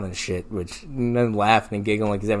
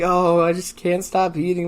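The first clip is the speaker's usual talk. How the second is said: higher, sorrowful confession, imitated; mock sad; 6 semitones higher than usual. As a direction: imitate a tearful contestant, lightly. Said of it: "oh, just sad".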